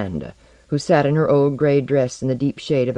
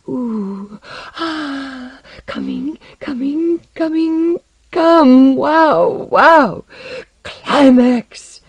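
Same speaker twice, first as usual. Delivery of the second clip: very high mock climax, building louder; ululating cries; 13 semitones higher, 6 dB louder, much slower than usual. A narrator's reading. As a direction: wail higher and louder to a hammed-up peak. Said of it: "She cried ululatingly".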